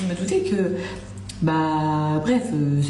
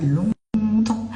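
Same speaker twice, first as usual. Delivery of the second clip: a long, lingering longtemps; longtemps drawn out; 4 semitones higher, 3 dB louder than usual. Real, not acted. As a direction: stretch the vowel of longtemps. Stressing how long ago it was.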